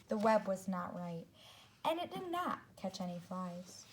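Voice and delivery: put-on storybook reading for young kids; children's story narration; read-aloud narration. A narrator's reading. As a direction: read it to a class, clear and gentle. Teacher reads picture book aloud.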